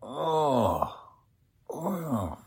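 pained moaning oh; dazed groaning. Acted. The doctor coming to after the crash, moaning. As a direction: come round after the crash, hurting.

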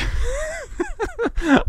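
high giggle; laughing. Real, not acted. Podcaster laughing at his own goofy act, high giggle. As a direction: crack up at your own bit.

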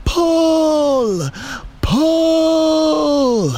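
high, long-held straining cries; all-out rallying yell; shouting. A narrator's reading. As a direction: heave each shout out longer. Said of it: "everyone shouted".

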